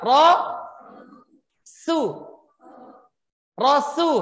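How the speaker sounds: Ro-su split into two slow syllables; syllable demo. Shown as a demonstration. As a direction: model Ro-su syllable by syllable.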